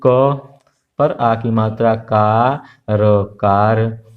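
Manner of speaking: sounding out कार slowly, letter by letter; slow reading demo. Shown as a demonstration. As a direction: drag each part for the pupils. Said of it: "तो यहाँ देखिए". (धीरे-धीरे वर्ण जोड़कर पढ़ना)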